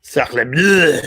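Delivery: hammed-up French accent. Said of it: "Oh boy, he's speaking French".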